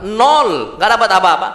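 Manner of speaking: normal talking voice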